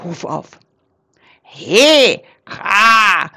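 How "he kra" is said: loud affronted croak; loud outraged caw; indignant raven cry. A narrator's reading. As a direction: call out like an offended raven. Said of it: "rief Krax empört".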